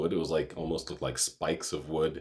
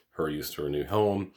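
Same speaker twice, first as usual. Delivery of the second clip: ordinary delivery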